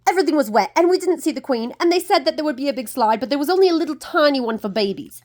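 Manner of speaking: high, fast complaining voice; whiny sister. A narrator's reading. Maddie's sister complaining to Maddie, high and whiny. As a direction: moan it like a sulky big sister. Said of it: "said Maddie's sister".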